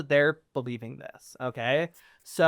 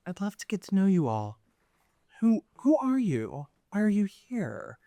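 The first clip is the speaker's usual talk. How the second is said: gracious noble lady; 5 semitones higher than usual. Acted. Noble lady welcomes the troupe.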